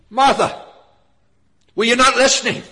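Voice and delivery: loud, high, sharp reproof; silencing rebuke. Acted. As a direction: call her up short, raising the voice. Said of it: "the Lord silenced Martha".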